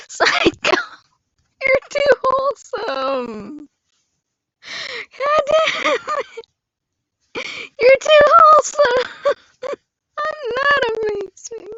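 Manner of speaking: high tearful wail broken by laughs; crying without tears. Real, not acted. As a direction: whine it, overcome by kindness. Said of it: "I'm crying now".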